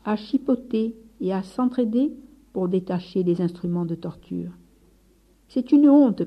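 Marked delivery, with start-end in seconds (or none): 5.5-6.2 high, loud cry of shame; indignant shout; outraged cry. Acted. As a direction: cry out against the brothers' excess. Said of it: "cria François".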